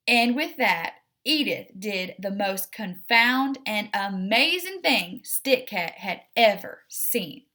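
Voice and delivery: ordinary delivery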